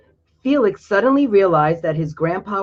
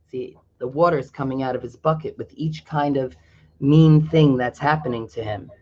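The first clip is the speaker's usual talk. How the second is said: plain reading voice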